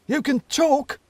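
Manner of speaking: high, loud astonished cry; amazed hedgehog. Acted. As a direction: gasp it out in wonder. Hedgehog amazed at talking deer.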